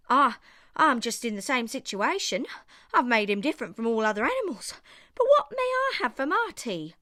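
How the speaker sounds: a hungry dog complaining he's owed his supper; whiny hungry dog. A narrator's reading. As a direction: plead for your supper like a starving dog. Dingo whines about his tea.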